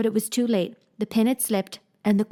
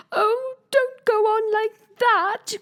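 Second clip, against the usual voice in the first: high, slow pleading; pleading cry; 14 semitones higher, 4 dB louder, much slower than usual. Acted. Queen begs Alice not to cry, high slow pleading. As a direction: beg her not to cry. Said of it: "cried the poor Queen".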